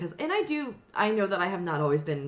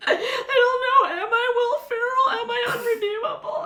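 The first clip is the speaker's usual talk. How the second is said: high, loud crying cry-out; mock sobbing wail; 6 dB louder than usual. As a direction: wail it through tears, half laughing. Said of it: "I'm literally like".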